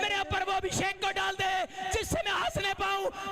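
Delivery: high, loud, shouted preaching; preaching at full force. Acted. Preacher urging the congregation to laugh in joy. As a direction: fire up the church to rejoice.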